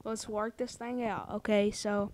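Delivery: quiet placating customer-service murmur; soothing hush. Acted. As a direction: talk her down gently. Caller soothes angry customer.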